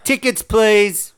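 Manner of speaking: loud, slow, high shouted call; hammed-up conductor's call; mock shout. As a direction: bellow it like a bus conductor. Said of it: "ran past him and shouted".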